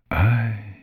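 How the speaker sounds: hesitant, careful first word; cautious old basilisk; slow, careful. A narrator's reading. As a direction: begin warily, drawing it out. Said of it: "in a slow, careful voice".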